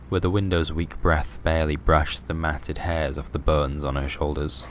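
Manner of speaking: ordinary delivery, nothing marked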